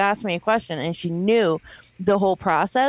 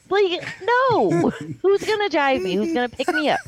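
higher, indignant retold protest; indignant refusal; 8 semitones higher than usual. Kid refusing a school two hours away, indignant. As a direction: reel off objections in a raised pitch.

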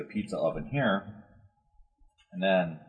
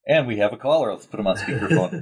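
louder, higher pretend call-in host; mock radio host; 5 semitones higher, 7 dB louder than usual. Host jokingly announcing a caller to the group, mock radio host. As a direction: announce a caller like a talk-show host, tongue in cheek.

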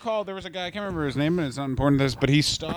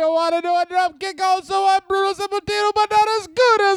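very high, hammed-up Italian trainer; piercingly high mock Italian; cartoon Italian accent; 12 semitones higher than usual. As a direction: squeal it out like a comic Italian coach. Impersonates Italian wrestling trainer.

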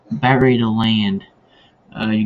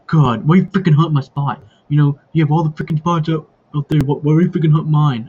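higher, louder, indignant rant; angry brother; 5 semitones higher, 4 dB louder than usual. Retold or imitated. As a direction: whine it like a kid brother whose spot got taken. Brother angry about his hunting spot.

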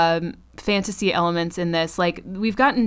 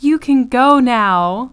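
imitated grand release; 4 semitones higher, 5 dB louder, slower than usual. Acting out Nadine releasing Ed.

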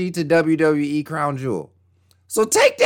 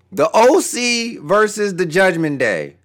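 ordinary talk

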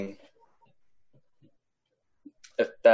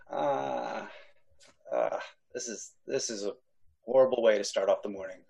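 much softer, half-crying dismay; 3 semitones lower, 8 dB quieter than usual. Real, not acted. Player groaning at the grim start to the morning. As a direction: mumble it out, overwhelmed.

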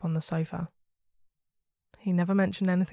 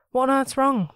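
high, loud startled question; a mother shocked by her frightened child; alarmed mother; 7 semitones higher, 7 dB louder than usual. A narrator's reading. Mum sees terrified child.

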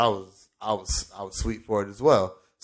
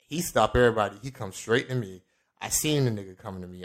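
ordinary talk

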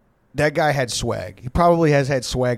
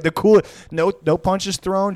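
ordinary delivery

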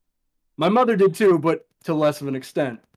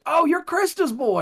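put-on high older local voice; 13 semitones higher than usual. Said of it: "they're like".

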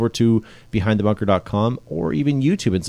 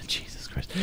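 hushed whisper; 15 dB quieter than usual. Real, not acted. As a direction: whisper it under the breath. Whispered aside, unvoiced.

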